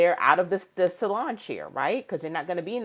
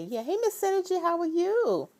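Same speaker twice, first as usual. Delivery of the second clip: imagined friendly greeting; 11 semitones higher than usual. Retold or imitated. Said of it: "No one comes and say".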